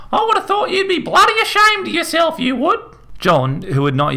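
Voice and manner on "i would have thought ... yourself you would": an outraged mother screeching in the doorway. A narrator's reading. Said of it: "she screeched".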